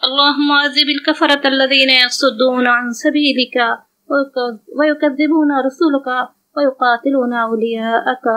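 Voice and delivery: ordinary talk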